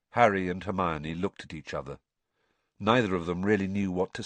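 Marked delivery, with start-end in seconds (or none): none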